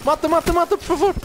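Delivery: high, urgent begging; frantic pleading. Real, not acted. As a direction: beg them to finish the kill. Begging teammate to kill.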